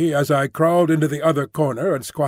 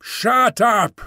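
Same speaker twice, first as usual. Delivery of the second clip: higher, drawn-out creature order; strained creature command, pitched up; voice from the dark; 6 semitones higher than usual. A narrator's reading. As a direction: cut in as an unseen beast. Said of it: "said the voice from the dark".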